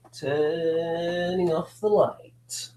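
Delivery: slow, eerie horror voice; spooky voice. Acted. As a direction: draw it out like a haunted-house host. Spooky retake of same line.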